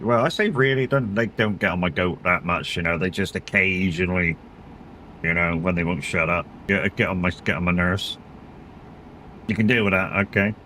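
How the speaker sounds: ordinary talk, nothing marked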